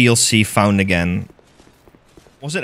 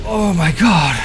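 exclaiming; 3 semitones higher than usual. Real, not acted. Surviving the boss.

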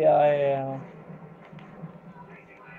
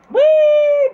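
high, loud squeal of glee; gleeful whee; 12 dB louder than usual. Real, not acted. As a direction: shriek with delight like on a slide. Playful in-game jump.

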